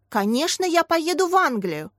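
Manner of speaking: eager, rising exclamation; animated teacher's cry; exclaiming. A narrator's reading. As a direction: burst out with enthusiasm, voice climbing high. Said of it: "воскликнула Виолетта Фёдоровна".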